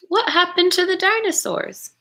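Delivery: ordinary talk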